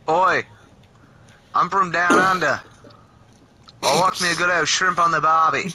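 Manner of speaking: mock Australian accent, put on for a gag; a lightly done Aussie "down under" voice; playful hammed-up Australian accent. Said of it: "except for the Australian".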